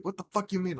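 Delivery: high, incredulous mock demand; imitated baffled mom. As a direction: play a confused, irritated mom.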